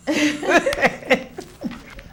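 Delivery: high, bubbly laugh; real laugh. Real, not acted. Host laughs after asking guest to share, real laugh. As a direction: laugh out loud in short bursts.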